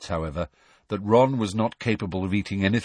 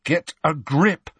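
higher, slow, stern command; 7 semitones higher, 3 dB louder, slower than usual. A narrator's reading. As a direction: tell him off slowly. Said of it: "said Harry sternly".

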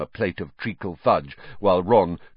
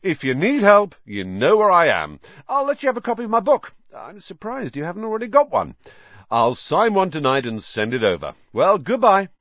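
higher, loud self-satisfied voice; a higher, pompous celebrity teacher; vain Lockhart; 9 semitones higher, 4 dB louder than usual. Acted. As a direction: preen over his own book. Lockhart boasting to Hagrid.

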